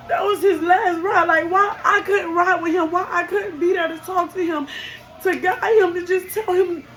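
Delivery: high, loud, voice breaking into a cry; anguished questioning. Real, not acted. Mother asking why she couldn't ride with her son, anguished and tearful. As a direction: plead with the unfairness, near tears.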